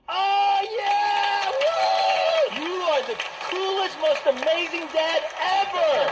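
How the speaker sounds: very high, gushing mock fangirl cheer; mock cheering girls. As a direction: squeal like a crowd of fans. Girls cheering him as dad.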